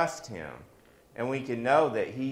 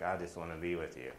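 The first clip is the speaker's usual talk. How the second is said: soft horse voice; 7 semitones lower, 11 dB quieter, faster than usual. Pretend.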